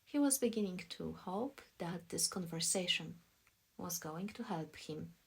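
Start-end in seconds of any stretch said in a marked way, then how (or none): none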